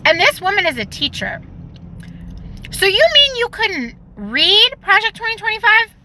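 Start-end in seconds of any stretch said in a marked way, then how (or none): 2.7-5.9 high, incredulous sarcastic question; mocking disbelief. Real, not acted. Mocking a teacher who never read the plan, in high disbelief. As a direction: act stunned that she couldn't read it.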